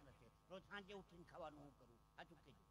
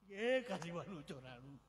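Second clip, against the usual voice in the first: mock alarmed shout; 11 dB louder than usual. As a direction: belt it out like a comic false alarm. Replaying the misheard loud shout.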